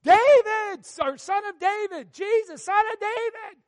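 very high, very loud desperate scream. Acted. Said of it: "made him shout more".

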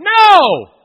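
a high, loud, explosive shout of No!. Retold or imitated. Pastor rebuking the devil in his room, shouting No!. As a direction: hurl No! out to drive the thought away. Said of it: "I went".

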